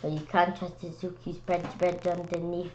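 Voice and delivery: plain reading voice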